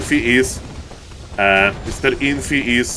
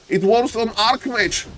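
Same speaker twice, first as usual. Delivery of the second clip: ordinary talk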